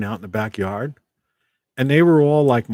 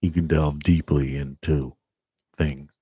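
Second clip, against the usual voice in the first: much lower, deep voice; 7 semitones lower, 4 dB quieter than usual. Shown as a demonstration. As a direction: drop the voice down low. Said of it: "if you wanna go deeper".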